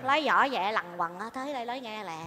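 very soft, low confidential voice; secretive low murmur; hushed. Acted. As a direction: drop right down so nobody overhears. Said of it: "Bà nói nhỏ nhỏ, đừng nói lớn nha".

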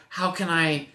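normal talking voice

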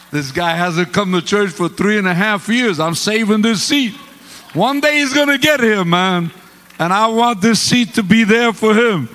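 loud, high, mock-earnest defense of the seat; hammed-up insistence. To the preacher, loud hammed-up seat defense. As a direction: ham up the stubborn seat saver.

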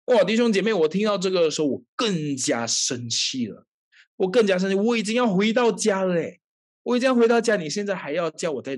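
high, loud exasperated cry; angry outburst. Retold or imitated. Preacher reliving anger at mother's call, loud exasperated cry. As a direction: relive the fury at full voice.

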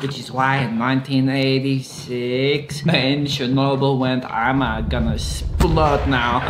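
low put-on Russian accent; mock Russian storyteller. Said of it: "Your Russian accent has slipped a little bit".

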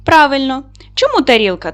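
ordinary delivery

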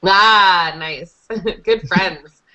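ordinary talk

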